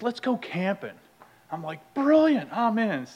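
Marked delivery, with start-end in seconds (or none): 0.0-1.0 lightly eager, higher-pitched proposal; eager suggestion. Retold or imitated. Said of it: "And he says". 1.9-3.1 high, loud burst of eagerness; retold enthusiasm. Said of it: "I was all about it".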